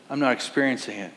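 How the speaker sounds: ordinary talk, nothing marked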